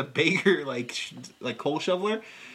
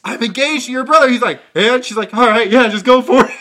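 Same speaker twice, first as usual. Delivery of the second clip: louder, higher hammed-up back and forth; mock exasperation; 6 semitones higher, 11 dB louder than usual. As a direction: play both sides over the top. Acted exchange, loud high voices.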